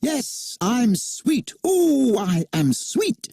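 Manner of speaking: high, slow, cocky crowing; boastful Peter. A narrator's reading. Peter boasts to Wendy, high cocky crowing. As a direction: preen and show off shamelessly. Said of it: "forgetting his manners again".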